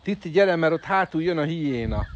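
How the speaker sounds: playful urgent summons; calling out. Real, not acted. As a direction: hurry a pet along, loud and high. Calling animal with playful warning.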